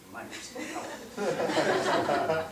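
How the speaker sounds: a hint of a slow, somber funeral director; mock-solemn condolence, lightly voiced. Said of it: "with all the enthusiasm of a funeral director".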